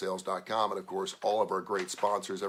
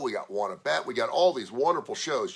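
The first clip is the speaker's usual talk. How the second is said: usual speaking voice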